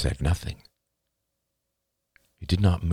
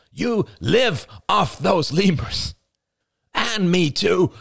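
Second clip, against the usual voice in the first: high, forceful, hammering each word; heated comeback; 14 semitones higher, 4 dB louder, slower than usual. Acted. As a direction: shout back in a bitter quarrel. Acted shouting match continues.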